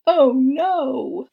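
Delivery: loud, high "oh no" of dismay; mortified gasp. Acted. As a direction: react aghast at what she did. Amelia mortified by the cork.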